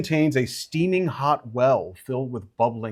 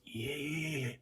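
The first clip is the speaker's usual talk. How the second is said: little creature; 17 dB quieter than usual. Acted. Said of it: "the little one goes".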